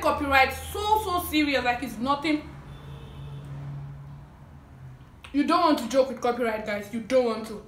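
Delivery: speaking with food in mouth; eating between words; talking while chewing. Real, not acted. Seen 1: Food taken at -2s; speaks with pursed, working mouth amid bites.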